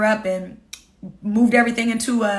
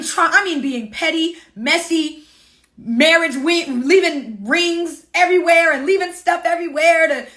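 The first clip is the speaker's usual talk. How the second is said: ordinary talk, nothing marked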